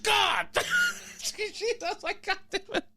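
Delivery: high startled yelp; startled outburst. Retold or imitated. Ball bouncing off their cart, high startled cry.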